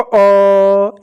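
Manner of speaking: अ held long on one steady note; drawn-out अ. Shown as a demonstration. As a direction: sustain अ to show the sound. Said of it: "इस तरह से ध्वनि सुनाई देती है". (अ की लंबी, एक-सी ध्वनि)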